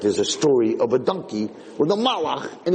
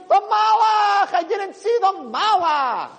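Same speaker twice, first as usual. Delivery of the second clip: much higher, slow shouted cry; mock panicked shout; slower than usual. Parents blaming the angel, slow high mock shout. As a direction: cry it out in hammed-up alarm. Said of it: "we're walking around saying".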